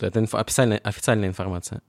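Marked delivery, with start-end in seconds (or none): none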